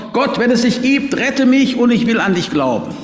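high, desperate cry for help; verzweifelter Schrei. A narrator's reading. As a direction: cry out to God in terror. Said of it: "In seiner Todesangst schreit er zu Gott". (verzweifelt und hoch zu Gott schreien)

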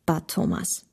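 plain reading voice

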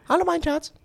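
high-pitched mock greeting; squeaky high voice. Reading postcard in put-on voice.

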